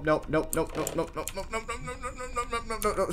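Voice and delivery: rising, frantic string of nopes; panicked nopes. Real, not acted. Gamer spotting the granny, panicking nope nope.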